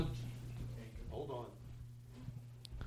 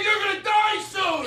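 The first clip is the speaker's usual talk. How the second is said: high, slow hammed-up shout; mock shouted insult; slower than usual. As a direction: yell it at the old drummer. Acted quarrel with the band.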